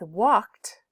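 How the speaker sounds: "walked" as one syllable ending in a crisp T; final T sound. Shown as a demonstration. Teacher shows students that "walked" ends with a T sound. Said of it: "it ends with a T".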